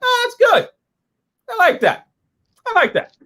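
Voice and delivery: very high-pitched little squeal of approval; high put-on fan voice; mock approval. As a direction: squeak it out in short, delighted bursts. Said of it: "I'm like".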